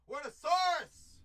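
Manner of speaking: loud, high name call; shout. Real, not acted. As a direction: yell the name out. Calling out the name.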